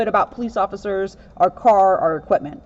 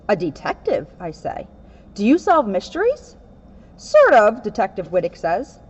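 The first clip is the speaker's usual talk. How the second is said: lively read-aloud storybook voice; picture book reading, lightly animated; reading to children. Acted. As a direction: read it to a room of kids.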